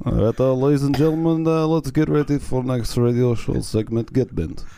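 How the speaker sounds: put-on colder Eastern Bloc Russian accent; deadpan Russian announcer. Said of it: "more cold, more Eastern Bloc".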